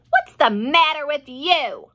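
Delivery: mild mock screech; screeching aunt. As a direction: snap at the boy. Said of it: "Aunt Spiker screeched".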